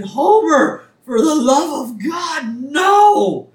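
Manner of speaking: high, loud crying shout; anguished cry. Retold or imitated. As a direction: scream out in horror. Marge sees Homer's hanging shadow.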